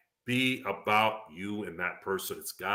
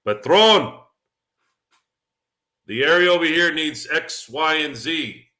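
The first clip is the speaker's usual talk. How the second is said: loud, raised call-out to the crew; acted boss on a call; 8 semitones higher, 8 dB louder than usual. Boss phoning instructions to his guys, loud acted call. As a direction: hail them high and loud, as if giving orders.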